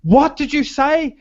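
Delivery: high, slow scandalised question; shocked customer. Retold or imitated. Customer mishearing the waitress, shocked. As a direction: draw it out high in mock disbelief.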